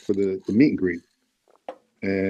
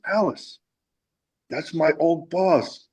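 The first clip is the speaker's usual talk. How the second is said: retold emotional exclaim; 8 semitones higher, slower than usual. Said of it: "he got emotional".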